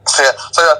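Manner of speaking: ordinary talk, nothing marked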